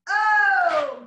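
loud, bright burst of praise; excited exclamation. Real, not acted. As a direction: cheer them on with a big Oh. Cheering class on mid-exercise.

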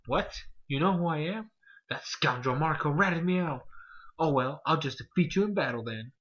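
loud, put-on villain; villain boss voice. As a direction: ham up the caught villain.

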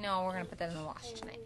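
plain reading voice

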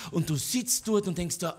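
ordinary delivery

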